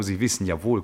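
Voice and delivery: usual speaking voice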